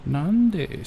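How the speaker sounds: high loud Nande falling; surprised. Real, not acted. Reacting to a chat message, surprised. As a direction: exclaim Nande in disbelief.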